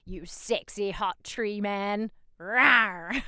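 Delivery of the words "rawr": flirty growl. Pretend.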